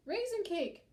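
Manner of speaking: high, loud mob cry; shouted high like a brawler; yelling. A narrator's reading. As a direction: yell it over a free-for-all. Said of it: "yelling things like".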